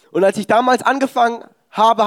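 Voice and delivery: normal talking voice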